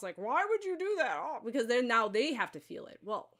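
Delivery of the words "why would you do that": high, loud, put-upon whine; aggrieved mock victim cry; wounded martyr. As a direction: play the martyr on the cross. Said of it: "they're like Christ on the cross".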